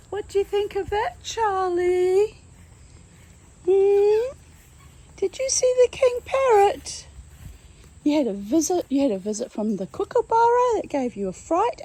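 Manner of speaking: high, loud sing-song to a pet; baby talk. Acted. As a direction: gush at the bird. Talking to pet bird loudly.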